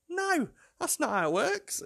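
very high mock protest; exasperated correction. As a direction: squeal the correction in mock disbelief. Re-enacts protest to daughter.

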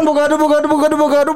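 ordinary talk

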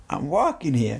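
mock New York American accent. Co-host answering her offer to talk American, hammed-up American accent. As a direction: put on an American street-tough accent. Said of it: "should I talk like an American".

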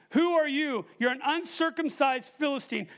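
high, loud challenge to a giant; defiant taunt. Acted. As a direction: throw it out as a preacher's dare. Models defiant words to the giant.

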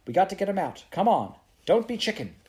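high, loud and insistent; urgent urging. Acted. Prue pressing Mary Joe to go in, urgent. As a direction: push her to come along. Urging frightened friend into fire.

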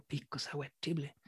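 a stunned, barely audible echo of the order; hushed echo. Retold or imitated. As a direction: repeat it to yourself in disbelief, hushed and slow. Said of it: "yo escuché así como".

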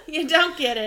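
put-on loud Jersey mom accent; huffy Jersey mom brush-off. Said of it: "a Jersey mom accent".

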